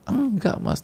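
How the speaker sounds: high, crying refusal; wife crying, refusing to explain, high and tearful; tearful denial. Acted. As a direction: choke it out high through tears. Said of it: "nangis lagi gitu loh".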